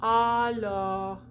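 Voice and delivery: alors drawn out, dark "aw" with lots of lips; open O. Shown as a demonstration. Teacher modelling open O, well-rounded vowel. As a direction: say "Alors" long, lips pushed out. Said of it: "Lots of lips".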